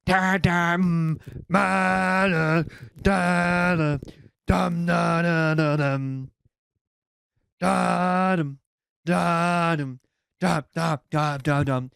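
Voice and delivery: loud droning da-dum riff, imitating a distant stereo; mimicked music. Homeowner hears the builders' music: loud droning mimicry. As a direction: sing the muffled music heard through the door.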